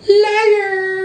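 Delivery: high, sharp cry of liar; mock accusation. Mocking his own vow to quit contests, high cry. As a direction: call yourself out, high and teasing.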